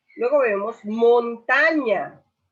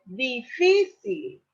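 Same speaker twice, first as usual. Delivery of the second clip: difícil drawn out slowly with fí raised; slow stressed word; 5 semitones higher, much slower than usual. Shown as a demonstration. Teacher slowly sounds out difícil for the class. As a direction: stretch difícil and lift fí. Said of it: "las distinguimos que es di y si".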